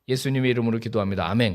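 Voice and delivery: fast, tossed-off closing prayer; rushed amen. Retold or imitated. Worshipper rushing the amen to go home, fast. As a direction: rattle through the ending to leave.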